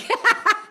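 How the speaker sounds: a high burst of laughter; real laugh. Real, not acted. Laughing at her own returning anger, high laugh. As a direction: laugh at herself.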